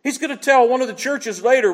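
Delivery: ordinary talk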